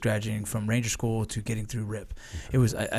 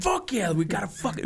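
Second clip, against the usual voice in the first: excited cheer; 8 semitones higher than usual. Retold or imitated. Student cheering at lucky spin.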